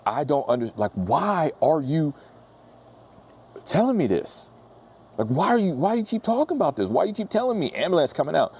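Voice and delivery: high-pitched, baffled why-are-you-telling-me; baffled exasperation. Retold or imitated. Parking guy baffled by EMT ambulance calls, exasperated high voice. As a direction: climb way up in pitch, totally puzzled. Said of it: "And I'm sitting here like".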